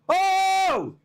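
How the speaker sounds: sudden high startled cry; jolted by the breaking news; real surprised yelp; surprised exclamation. Real, not acted.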